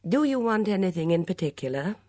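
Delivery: mildly frosty, formal question; coldly. A narrator's reading. Woman questions her unwanted visitor in the garden, coldly. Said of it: "asked Philippa coldly".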